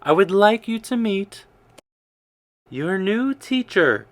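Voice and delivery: announcing. A narrator's reading. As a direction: present her proudly to the class. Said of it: "she led Sarah ... and said".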